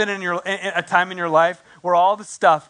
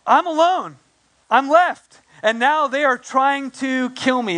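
whiny Elijah; 5 semitones higher than usual. Retold or imitated. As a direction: wail it like a man who feels abandoned. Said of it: "And Elijah replies".

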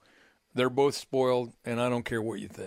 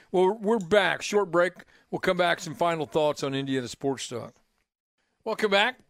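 radio host announcing a break; 4 semitones higher than usual. Acted.

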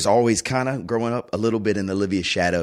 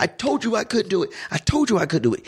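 much higher, imitating a sobbing, furious child; high-pitched imitation of a distraught child; hysterical little girl; 13 semitones higher than usual. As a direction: play the daughter wailing it at her dad after the lost game.

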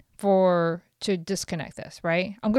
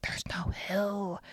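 slow, voiceless retort; exasperated whisper; 9 dB quieter, slower than usual. Retold or imitated. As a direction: whisper it back slowly at the nagging car. Exasperated retort to beeping car.

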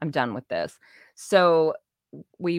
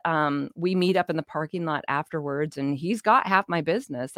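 ordinary talk, nothing marked

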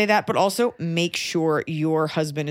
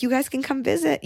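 higher, singsong placating line; 8 semitones higher than usual. Shown as a demonstration. Placating the in-laws, automatic singsong reply. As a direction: repeat it like an automatic pleasantry.

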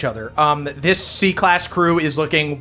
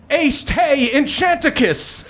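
high, slow spell incantation; boy wizard spell; 12 semitones higher than usual. Acted. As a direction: cast it like a proud little wizard. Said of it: "and goes".

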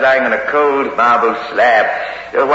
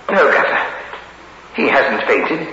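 ordinary talk

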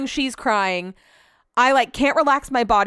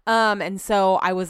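normal talking voice

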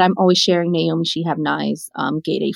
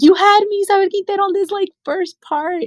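high-pitched gush of delight, much higher and louder; excited gush; 4 dB louder than usual. Retold or imitated. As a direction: squeal it like you just fell for the book. Excited reaction to a book.

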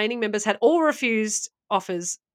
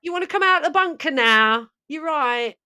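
put-on cheery north London accent, pitched up; 2 semitones higher than usual. Host calls down to the cult in bunker, north London accent. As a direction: coax them out in a chirpy north London voice. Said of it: "moved to, like, northern London".

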